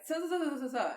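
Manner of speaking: high run of garbled syllables; mimicked indistinct talk. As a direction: imitate someone's words you can't make out. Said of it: "can't really hear".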